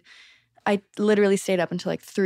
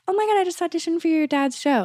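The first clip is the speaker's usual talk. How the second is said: put-on high, breathy-free gushing girl voice, much higher; gushing friend; 9 semitones higher than usual. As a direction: play the excited actress friend texting. Actress friends' texts acted out.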